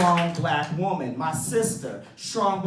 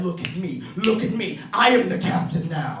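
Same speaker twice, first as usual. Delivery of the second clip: loud commanding mock pirate, shouting questions; hammed-up hijacker barking small talk; Captain Phillips pirate; 5 semitones higher, 6 dB louder than usual. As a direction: take over like the pirate captain. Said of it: "the pirate from the Captain Phillips movie".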